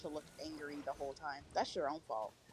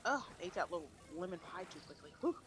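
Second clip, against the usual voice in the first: usual speaking voice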